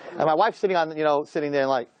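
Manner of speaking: ordinary talk, nothing marked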